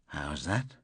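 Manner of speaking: low, soft whispered question. A narrator's reading. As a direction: lean in and whisper it. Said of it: "and whispered".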